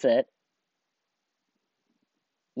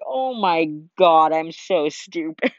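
louder, slow laughing groan; laughing self-scold; 5 semitones higher, 7 dB louder, slower than usual. Retold or imitated. Retelling her blunder, slow laughing self-scold. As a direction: laugh at your own mistake. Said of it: "and I'm just like".

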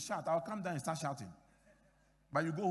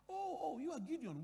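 embassy lady; 9 semitones higher than usual. Retold or imitated. Pastor voices Swedish embassy lady.

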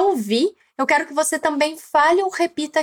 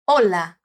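Hola with a low, falling end after HO; stress on first syllable; 9 semitones lower than usual. Shown as a demonstration. Teacher modelling Hola for repetition, stress on the O. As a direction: lean on the O of Hola. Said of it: "a ênfase tá no o".

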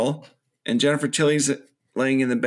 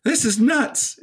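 higher, sarcastic Chucky; mock Chucky; 10 semitones higher, slower than usual. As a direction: toss it off high. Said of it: "he's like".

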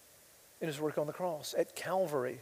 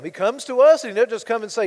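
preaching; 4 semitones higher, 12 dB louder than usual. Acted. Preaching at full force.

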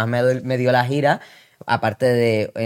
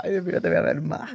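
mock heartbreak; 7 semitones higher, 4 dB quieter than usual. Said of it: "yéndote, diciendo".

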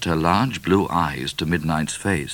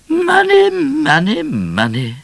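high cries stepping down to a sigh; sighing queen; 9 dB louder, slower than usual. A narrator's reading. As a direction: lament it in a queenly voice, falling each time. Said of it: "sighed the Queen".

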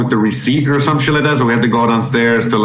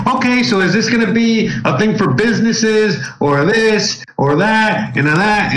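higher, slow, singled-out phrases; mimicked gibberish talker; 6 semitones higher, slower than usual. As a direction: imitate her droning list, drawn out and higher. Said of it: "talking all this gibberish".

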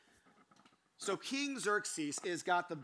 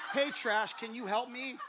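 high, loud, oblivious hollering; animated mock holler, punching the name; cheerful calling out; 6 semitones higher, 4 dB louder than usual. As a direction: call across the room to a coworker. Acting himself calling out to her.

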